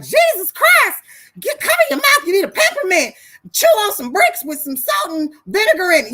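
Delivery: high, loud, mock-outraged yell; stranger's outburst. Rude stranger recoiling from bad breath, loud high outburst. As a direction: holler it in exaggerated disgust at the smell. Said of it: "Some stranger that, you know, you might be like".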